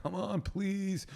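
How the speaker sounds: high, soft, drawn-out begging; slow, quiet mock whine; mock pleading. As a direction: imitate a clingy dad wheedling his kid. Acts out clingy dad begging daughter.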